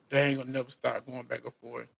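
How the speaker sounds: ordinary talk, nothing marked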